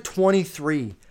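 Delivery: usual speaking voice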